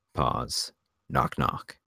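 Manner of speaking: ordinary talk, nothing marked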